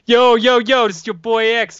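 loud, high hammed-up gangster. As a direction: yell it like a hype-man rapper. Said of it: "If only I could do a good gangster voice".

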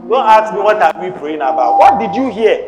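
forceful preaching. Real, not acted. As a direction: press the congregation with driving questions. Exhorts congregation to pray.

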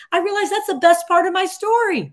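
high, loud burst of realization; student's revelation. Retold or imitated. Student telling the MBA class his story, high excited. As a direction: voice his joy at the discovery. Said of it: "he's like".

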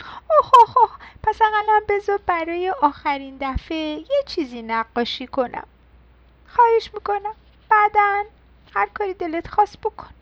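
high, imploring little pencil voice; pleading. Acted. Pencil asks the mouse for one last drawing, pleading. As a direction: plead up high, opening on startled ohs.

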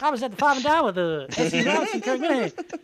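lightly put-on yokel voice chattering to a radio host; Hillbilly Jim. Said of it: "I was speaking like Hillbilly Jim".

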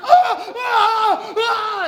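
repeated loud, high screams; acted howls of pain; agonized screams. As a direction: scream as if being mauled. Acting man mauled by bear.

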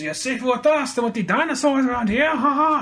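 high, hammed-up French-accented villain. Mercenary taunting the man he found, mock French accent. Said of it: "I gave him a French accent".

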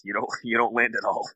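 ordinary delivery

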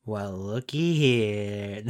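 slow, drawn-out gotcha; mock predator-catcher reveal; switched voice. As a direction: stretch it out like catching someone red-handed. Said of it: "change my voice".

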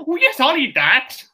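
eager dopey voice. Retold or imitated. Voicing the party as dog.

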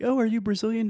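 high, loud retold surprise; amazed question. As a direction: act out astonished recognition. Acting out amazed question to host.

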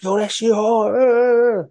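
high, loud excited shout; hyped up. Retold or imitated. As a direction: yell it like the verse blew you away. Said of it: "He's like".

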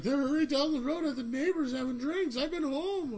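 high, mock-innocent kid's protest; fake innocence. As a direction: sell the alibi like a guilty teen. Lying to the sheriff.

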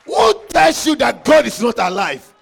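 loud, high, shouted preaching; preaching at full force. Acted. Preacher shouting to the congregation about demons, loud and high. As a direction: hammer it home to the church.

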